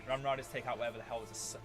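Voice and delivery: ordinary talk, nothing marked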